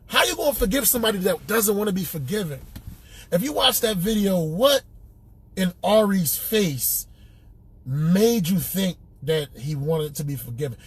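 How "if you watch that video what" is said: ordinary delivery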